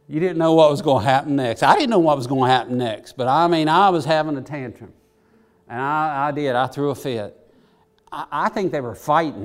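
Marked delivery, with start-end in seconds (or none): none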